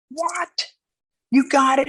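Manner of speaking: a sudden jolt of disbelief; shocked Savio. Retold or imitated.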